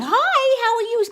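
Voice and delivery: put-on high, bright little kid voice calling out; child's voice. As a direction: chirp it like a small friendly child. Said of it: "this voice keeps saying".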